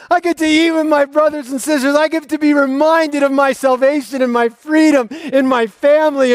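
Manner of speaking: a believer who can't wait for communion morning; overjoyed churchgoer. Pretend. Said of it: "wakes up ... going".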